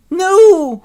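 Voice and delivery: high, loud, drawn-out wail; horrified protest. Acted. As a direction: cry out in hammed-up horror. Oliver is caught again.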